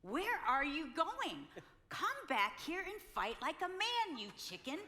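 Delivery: loud, high call after him; mock yell. Wife yelling after her husband walking away. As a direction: shout at him to come back and fight. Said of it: "and I said".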